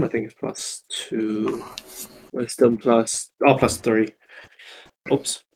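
usual speaking voice

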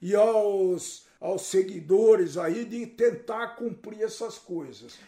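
slow, mock-pious apology; ironic solemnity. Real, not acted. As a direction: beg forgiveness with stately, drawn-out irony. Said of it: "Ele tá sendo irônico aqui".

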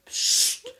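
ein scharfes, tonloses Zischen zum Ruhigmachen; psst, gezischt wie zu einer lauten Freundin; zischendes Psst. Retold or imitated. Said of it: "fünfzehn bis achtzehn Mal sage ich". (zischend zur Freundin, die nachts zu laut ist)

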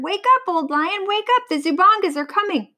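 high-pitched urgent warning; frantic bird. A narrator's reading. Vulture warning the sleeping lion, frantic alarm. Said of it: "screeched the frantic bird".

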